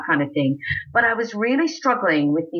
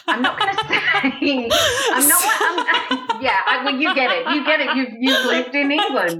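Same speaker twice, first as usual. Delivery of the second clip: high giggly hedging; giggly, knowing aside; laughing; 9 semitones higher than usual. Real, not acted. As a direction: laugh through the words.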